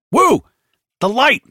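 high, loud, desperate demand; exasperated battlefield yell; shouting. A narrator's reading. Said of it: "Hicks shouted".

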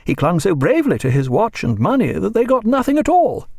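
put-on high, chattering elderly lady; Mrs Williams voice. A narrator's reading. Narrator voicing Mrs Williams, high and talkative. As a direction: pitch her well above the narration.

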